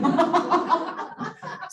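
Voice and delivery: loud real laugh; hearty laughing burst; burst of laughter. Real, not acted. Laugh after girls' teasing.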